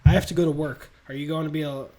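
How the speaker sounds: quick back-and-forth, lightly voiced; rapid fire dialogue. Peter and Aunt May trade quick lines, rapid. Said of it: "this is all rapid fire dialogue".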